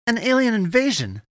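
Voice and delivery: high, loud exclamation; horrified cry. A narrator's reading. Eddie grasps the threat to Earth, horrified cry. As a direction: cry out in shocked realisation. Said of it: "he cried".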